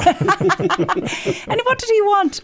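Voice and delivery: slow, high, laughing question; mock child asking through laughs; laughing disbelief. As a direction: ask it through laughter, amazed.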